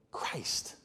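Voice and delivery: breathy whispered name. Real, not acted. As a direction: drop to a hushed whisper on Christ. Whispered climax word.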